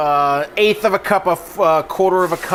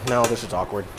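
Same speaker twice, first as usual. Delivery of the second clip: ordinary talk, nothing marked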